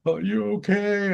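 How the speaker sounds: high-pitched mock weepy question; imitated tearful wife. Bawling wife checking on her husband at night, imitated weepy. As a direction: wail it lightly, high, as a retold worry. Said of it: "she's just bawling all night long".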